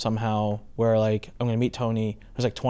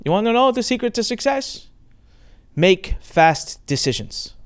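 Tony's slow pronouncement; 8 semitones higher, slower than usual. Retold or imitated. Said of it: "he looks right at me and he goes".

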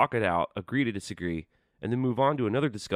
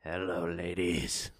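slow, low charmer voice; smooth flirty greeting; 3 semitones lower, 6 dB quieter, slower than usual. Pretend. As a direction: purr it to the ladies. Greeting female listeners flirtatiously.

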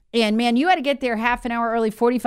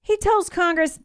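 normal talking voice

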